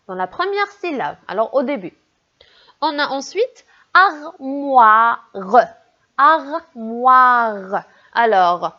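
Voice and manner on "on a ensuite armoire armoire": armoire, wa stretched slowly; slow demo word. Shown as a demonstration. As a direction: say armoire slowly, leaning on the wa at the end. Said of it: "on a le son wa vers la fin".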